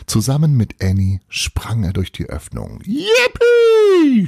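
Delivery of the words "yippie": high, loud yippee of glee; boy yelling as he leaps through the window; joyful shout. A narrator's reading. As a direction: let out a gleeful kid's yell. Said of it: "schrie er wieder".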